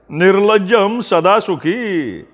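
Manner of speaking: high, drawn-out sing-song jibe; mock muttering. A narrator's reading. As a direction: grumble it aloud as a mocking maxim. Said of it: "असं पुटपुटत भैया आत".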